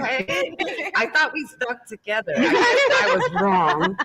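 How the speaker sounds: high, laughing fake hurt; hammed-up laughing sulk; mock betrayal. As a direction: pretend to be wounded, giggling. Mock offended over Superman.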